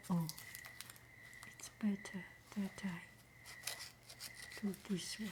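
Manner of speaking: soft breathy whisper, close to the mic; ASMR whisper. Acted. Opening a friend's mail on camera, soft whisper. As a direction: hush each word as if sharing a secret.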